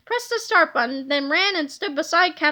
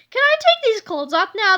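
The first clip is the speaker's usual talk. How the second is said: higher, louder eager plea; pitched-up pleading hero; 6 semitones higher, 5 dB louder than usual. A narrator's reading. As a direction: beg to undress. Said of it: "said Captain Underpants".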